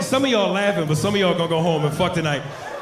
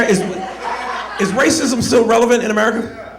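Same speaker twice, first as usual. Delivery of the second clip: louder, higher insistent question; raised-voice repeat, pushing for an answer; exasperated repeat; 5 semitones higher, 4 dB louder than usual. Real, not acted. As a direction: drive the question home to a silent crowd. Repeats it to unresponsive audience.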